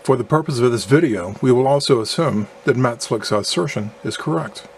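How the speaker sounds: ordinary talk, nothing marked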